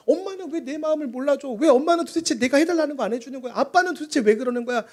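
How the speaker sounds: put-on high-pitched sulky kid voice; whiny child. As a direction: whine like a child asking why mum won't listen. Child complaining to parents. (높고 투정 부리는 아이 목소리)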